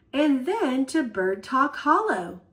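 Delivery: usual speaking voice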